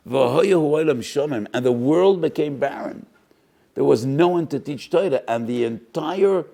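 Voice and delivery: loud, high, fervent preaching; a preacher mourning the lost scholars at full voice; impassioned preaching. Acted. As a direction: cry out the lament to the congregation. Preaching lament at full force.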